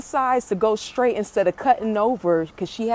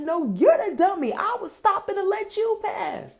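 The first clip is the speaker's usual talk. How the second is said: high-pitched indignant comeback; worked-up retold retort; letting her have it; 10 semitones higher than usual. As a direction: re-enact throwing the insult back, voice raised in pitch. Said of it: "I just let her have it".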